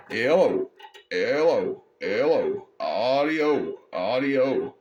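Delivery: drawn-out hello audio calls into the CB mic; stretched test calls, a touch of music behind; radio mic check. Shown as a demonstration. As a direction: call it out long like a radio check. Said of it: "You can hear it modulating".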